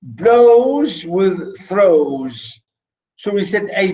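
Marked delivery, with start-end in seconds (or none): 0.0-2.6 "blows" and "throws" held very long; very slow, deliberate pairing of "blows" with "throws"; stressing the rhymes. Shown as a demonstration. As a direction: stretch the shared "o" in "blows with throws".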